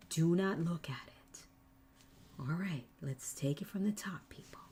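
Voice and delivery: hushed whisper to the class. Real, not acted. Crafter whispers to her livestream viewers to make them listen. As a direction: breathe it so they lean in. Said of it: "You wanna know why I'm whispering?".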